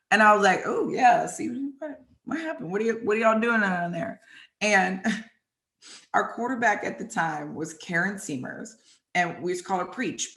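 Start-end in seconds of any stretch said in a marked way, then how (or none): 0.7-4.2 teasing. Retold or imitated. Retold teasing of fallen players.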